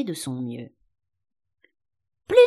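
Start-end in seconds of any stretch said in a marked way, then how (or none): 2.3-2.4 one very short, sudden cry. A narrator's reading. The sheep cries out while taking needles, abruptly. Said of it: "cria la brebis".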